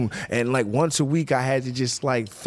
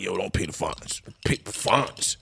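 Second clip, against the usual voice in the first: lawyer impression; 4 semitones lower, slower than usual. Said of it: "Is that what your lawyer sounded like?".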